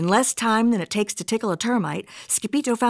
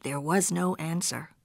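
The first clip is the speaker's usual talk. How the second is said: ordinary delivery, nothing marked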